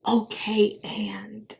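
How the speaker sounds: whispered stubborn insistence; soft, breathy defiant aside. Retold or imitated. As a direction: hush it, but hold your ground.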